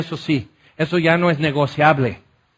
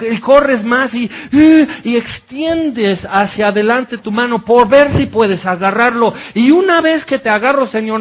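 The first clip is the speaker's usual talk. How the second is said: louder, higher, building sermon climax; fervent preaching; 3 semitones higher, 3 dB louder than usual. Acted. Preacher exalting Jesus' beauty to the congregation, fervent and loud. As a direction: pour it out to the congregation, rising in fervor.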